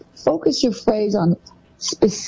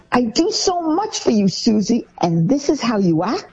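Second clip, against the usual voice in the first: higher, put-upon parent voice; guilt-tripping parent; 5 semitones higher than usual. Retold or imitated. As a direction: lay on the guilt like a hurt mother.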